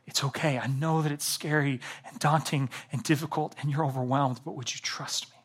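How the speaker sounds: put-on soft near-whisper, arm around a scared child; loving father whisper. Loving father comforting his frightened child, in a hushed whisper. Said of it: "this loving father that would come and just put his arm around me".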